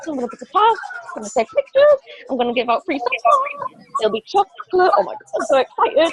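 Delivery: high, gushing mock invitation; excited pitch. [name] practising an event invite on Tasha, excitedly. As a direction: sell it to a friend with rising excitement.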